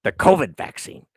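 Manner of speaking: normal talking voice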